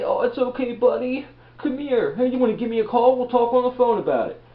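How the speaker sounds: high, syrupy fake comforting voice; mock a gushing online comforter; mock sympathy. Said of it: "give you a freaking cyber hug".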